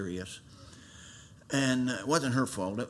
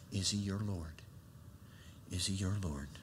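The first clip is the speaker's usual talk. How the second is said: soft appeal; 4 semitones lower, 9 dB quieter than usual. Real, not acted. Soft repeated appeal to listeners.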